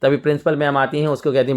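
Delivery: ordinary delivery, nothing marked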